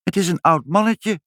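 put-on high-pitched child announcing a discovery; child's voice. A narrator's reading. Child peering through the door window reports, in a high voice. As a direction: pipe up like a small child. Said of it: "zei Ibbeltje".

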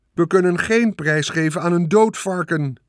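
high, indignant-sounding announcer; loudspeaker announcer. A narrator's reading. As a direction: object firmly over the loudspeaker. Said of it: "zei de luidspreker".

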